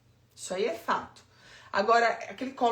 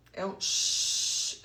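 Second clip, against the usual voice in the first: long slow shhh like steady rain; imitated rain hiss; 4 semitones lower, much slower than usual. Shown as a demonstration. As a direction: hush out the sound of rain noise. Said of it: "eu sempre usei som de chuva".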